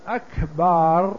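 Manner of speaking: slow "أكبر" said as "akbaar"; lengthened ba. Shown as a demonstration. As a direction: draw out the last vowel of "أكبر". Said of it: "يمد الباء ويأتي بألف بعدها". ("أكبر" بمد الألف بعد الباء)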